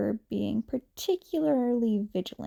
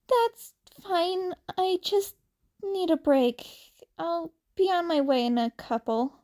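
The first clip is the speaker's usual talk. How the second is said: put-on higher, young upset boy; Izuku voice; 7 semitones higher than usual. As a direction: apologize in a higher, teary young voice. Izuku asks for a break.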